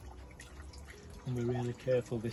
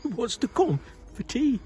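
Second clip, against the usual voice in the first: thrilled; 10 semitones higher, 8 dB louder than usual. Acted. Zacchaeus overjoyed.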